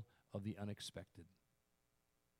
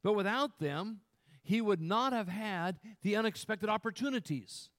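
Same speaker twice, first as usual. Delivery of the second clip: raised, louder pulpit voice; emphatic preaching; 9 semitones higher, 7 dB louder than usual. Real, not acted. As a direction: drive the point home to the congregation. Preaches at full force.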